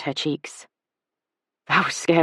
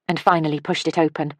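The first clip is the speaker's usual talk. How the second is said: usual speaking voice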